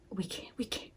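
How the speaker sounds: breathless whisper of longing. Retold or imitated. Quoting yearning lovers in a hushed whisper.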